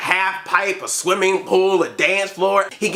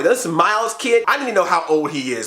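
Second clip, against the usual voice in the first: ordinary talk, nothing marked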